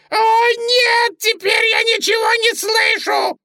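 very high, loud squealing shout; shrill-pitched full yell; yelling rabbit. A narrator's reading. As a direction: scream in panic as the bunny. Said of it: "продолжал орать кролик".

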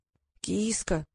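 softly voiced old man, in short phrases; slow old man. A narrator's reading. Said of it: "медленно произнося слова". (старик, тихо и медленно)

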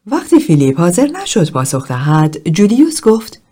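usual speaking voice

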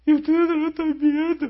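high, loud voice on the edge of tears; tearful outcry. Retold or imitated. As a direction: cry it out as tears break. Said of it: "Y empezó a llorar".

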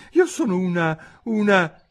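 high-pitched faltering self-defence; floundering Alice. A narrator's reading. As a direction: search for the word, high-voiced. Alice falters over what she is.

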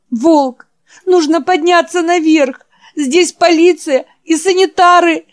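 high, loud frantic urging; urgent pleading. Acted. Jack urging the wounded Wolf to get upstairs, high loud plea. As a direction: push him to get up now.

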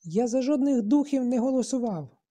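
high, loud indignant squeal; piercing shriek. A narrator's reading. Boy who grabbed the horn protests to the assembly, shrieking. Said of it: "голос трохи пронизливо заверещав".

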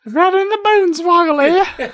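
high put-on English granny; comic British granny voice; British old lady. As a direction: copy the old lady in falsetto.

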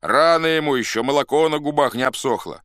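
loud, high heckling; scornful shout. A narrator's reading. Rival faction calls the candidate too young, shouting. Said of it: "кричала другая".

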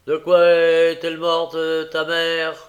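lancer « bâtard » fort et lentement, plus aigu; insulte méprisante. A narrator's reading. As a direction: cracher l'injure à l'orphelin. Noé revient à la charge. (gamin méchant qui insulte, fort et lent)

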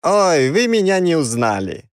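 put-on high squeaky Odessa accent; Odessa uncle squeak. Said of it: "Голосом дяди Изи из Одессы пропищал я".

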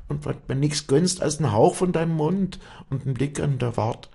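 high, fervent woman's vow; impassioned, self-abasing outpouring; remorseful Wally. Acted. Remorseful Wally vows to serve.

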